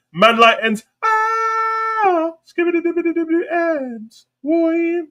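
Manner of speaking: high-pitched, drawn-out hype cry; playful, sky-high chant; hyped catchphrase. Pretend. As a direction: squeal the shout-out way up high.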